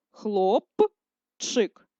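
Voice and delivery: хлопчик slowly split the other way; second syllable split. Shown as a demonstration. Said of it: "Другий варіант переносу".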